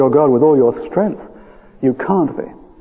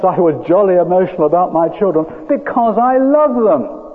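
higher, fervent pulpit delivery; impassioned preaching; 6 semitones higher than usual. Real, not acted. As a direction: declare it with heartfelt insistence. Preaches with rising fervour.

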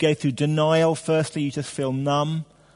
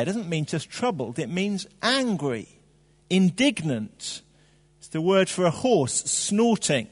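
ordinary talk

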